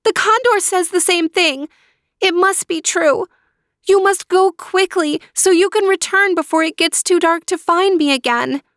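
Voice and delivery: put-on high, pleading young girl voice; high young sister pleading; girl calling out. A narrator's reading. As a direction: call to your brother through the wall. Natalia urges Luis.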